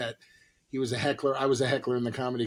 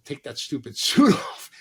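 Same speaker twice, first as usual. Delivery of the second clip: laughing through words; 5 dB louder than usual. Retold or imitated. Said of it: "He goes".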